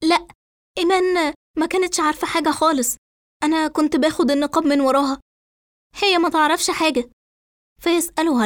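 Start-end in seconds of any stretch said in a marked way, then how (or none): 0.0-7.1 high, agitated denial; tense protest, pitched high; nervy anger. A narrator's reading. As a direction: defend the sister urgently and edgily. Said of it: "فترد إسراء بعصبية".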